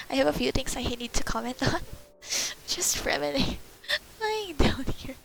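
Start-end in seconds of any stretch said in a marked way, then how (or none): 2.2-5.2 laughing. Real, not acted. Laughing at the scene.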